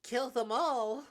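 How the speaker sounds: tense voice rising to a loud, high cry; building to a cry. Acted. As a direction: escalate into a high, loud outburst. Acted curse scene, rising to shout.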